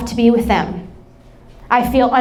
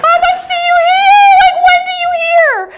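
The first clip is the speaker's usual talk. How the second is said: very high, loud squealed questions; hammed-up tearful panic, rushing out in a squeal; mock hysterical wail; 5 dB louder, faster than usual. Panicking mother questioning her daughter, hysterical wail.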